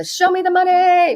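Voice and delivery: high, slow, drawn-out shout; movie quote shout. Retold or imitated. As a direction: belt it out like the famous movie line. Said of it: "I so badly wanna put up the".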